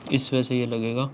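talking through a helmet visor; speaking with face enclosed; muffled inside closed helmet. Real, not acted. Seen 1: Closed dark visor covers the whole face while speaking; removed later.

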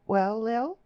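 high voice rising at the end; insistent, rising girlish query; pleading question. A narrator's reading. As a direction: prompt a friend hopefully for news. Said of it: "She said it pleadingly".

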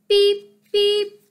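high, loud honking beeps; car horn imitation. A narrator's reading. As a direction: beep like a jeep horn. Imitates an army jeep's horn.

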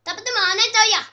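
loud mock Irish accent; bad Irish accent. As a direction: belt out a badly faked Irish greeting. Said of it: "That's the worst Irish".

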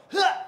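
sudden high, loud "huh"; demonstrated effort grunt. Shown as a demonstration. Coach showing a lifter how he goes, explosive grunt. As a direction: burst out a forceful huff. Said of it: "when you kinda go".

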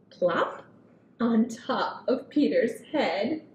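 put-on gentle read-aloud for kids; storybook reading. A narrator's reading. Reading picture book to children.

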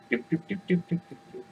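soft doops fading away; trotting-away noise. Acted. Titus trots off over bridge, fading doop-doops.

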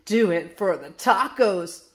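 mild yell in a put-on voice. A narrator's reading. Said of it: "Flip and Flap yelled".

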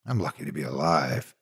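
ordinary delivery, nothing marked

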